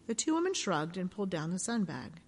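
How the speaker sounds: usual speaking voice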